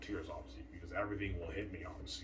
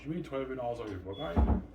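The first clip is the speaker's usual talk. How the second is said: imitating her, higher and faster, jumping up loud on the name; a playful shocked girlfriend, voice climbing; mock disbelief; 6 semitones higher, 7 dB louder, faster than usual. Girlfriend reacting to his twenty billion, mock astonished. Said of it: "She's giving that evil look".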